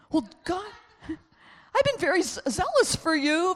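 high, loud, slow whine; whiny Elijah. Acted. Elijah complaining to God, high, slow and whiny. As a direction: plead like a self-pitying prophet.

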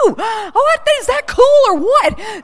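high, loud, drawn-out amazement; thrilled outburst. Real, not acted. Preacher marvels to the room at unity's power, thrilled. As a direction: gasp and gush with excitement.

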